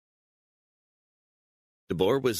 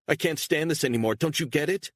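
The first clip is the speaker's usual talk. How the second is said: much higher, louder, fast, near tears; tearful outburst; 11 semitones higher, 4 dB louder, faster than usual. Acted. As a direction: break down and cry it out. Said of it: "tears in her eyes".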